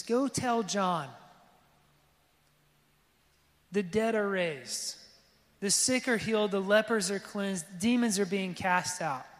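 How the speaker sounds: slow, deliberate, each clause weighed; measured declaration. Retold or imitated. Pastor quoting Jesus's reply to John, slow and deliberate. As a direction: hand down the answer slowly. Jesus's answer quoted slowly.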